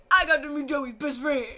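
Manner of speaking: loud, gushing fan yell; excited outburst. Real, not acted. Fan tells viewers about the best friend, loud excited outburst. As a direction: burst out with it, overjoyed.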